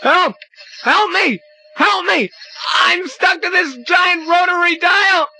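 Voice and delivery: panicked cry for help. Acted. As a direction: scream for rescue. Stuck to giant dial.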